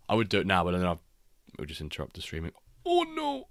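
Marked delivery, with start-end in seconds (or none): 2.8-3.4 squeaky mock dismay. Series of mock dismay takes.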